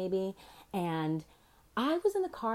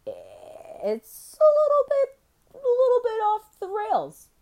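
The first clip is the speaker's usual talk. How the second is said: much higher, slow, sing-song drawl; high mock voice; 14 semitones higher, 8 dB louder, slower than usual. Calling modern feminism off the rails, high slow mock voice. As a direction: stretch it out high and loud. Exaggerated high drawn-out aside.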